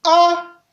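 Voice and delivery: A held on a high, level tone. Shown as a demonstration. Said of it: "Ça donne donc ceci". (« A » au ton haut)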